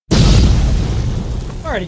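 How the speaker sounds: a long, loud, stretched-out alrighty; drawn-out alrighty. Real, not acted. Collector calling out to his viewers, loud and drawn out. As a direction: stretch the greeting way out.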